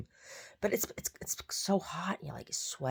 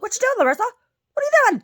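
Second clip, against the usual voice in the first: much higher, louder eager dog voice; be the excited little dog; voicing the dog; 13 dB louder than usual. Goldie the dog urging his dad, high eager voice. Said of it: "He's like".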